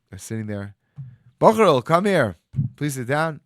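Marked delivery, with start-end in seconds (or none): 1.4-3.4 put-on loud, high call to the boy. Rabbi calling the student over, loud and high. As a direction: summon him across the room.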